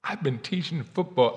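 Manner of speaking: ordinary delivery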